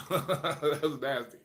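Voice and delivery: slow, laughing through the words. Real, not acted. Telling a friend about his old disgust, laughing. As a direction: chuckle it out as you remember.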